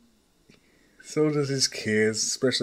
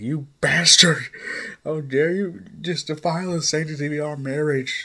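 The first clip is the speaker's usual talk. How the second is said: outraged wife's loud, higher-pitched tirade; angry yelling; 6 semitones higher, 6 dB louder than usual. Acted. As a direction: yell it like a betrayed wife. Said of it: "she is yelling and screaming like".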